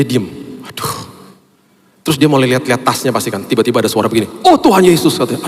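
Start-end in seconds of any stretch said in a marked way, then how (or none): 4.5-5.1 sudden high, loud gasp of alarm; mock alarmed wife crying out to God; startled cry. Wife panics finding keys.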